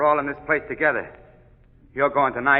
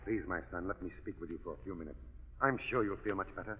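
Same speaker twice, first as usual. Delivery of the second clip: gentle pastoral; 8 semitones lower, 19 dB quieter than usual. Acted. Chaplain gently urges condemned man.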